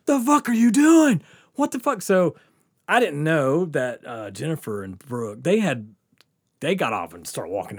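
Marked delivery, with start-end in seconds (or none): 0.0-2.0 high, exasperated cop yell; imitated yelling. Cop yelling at drunk mower rider, imitated yelling. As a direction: holler it in disbelief. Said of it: "he's just yelling, man".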